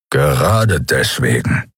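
low, slow growl; a low snarl of a reply. A narrator's reading. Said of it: "knurrte Keru".

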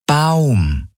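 Baum said very clearly and high, every sound crisp; over enunciating. A narrator's reading. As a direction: Baum spelled out slowly and distinctly. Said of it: "sagte sie überdeutlich".

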